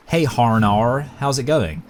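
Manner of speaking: slow "Harnar" with overdone American Rs; fake American accent. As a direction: greet in a mock American accent. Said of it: "you're American".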